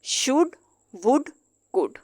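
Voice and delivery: शुड वुड कुड, the l dropped; silent l words. Shown as a demonstration. Teacher drills the modal verbs, l silent. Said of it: "pronounce नहीं करते".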